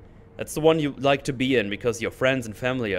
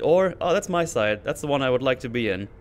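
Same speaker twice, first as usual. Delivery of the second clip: usual speaking voice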